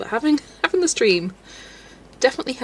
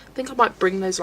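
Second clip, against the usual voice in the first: ordinary talk, nothing marked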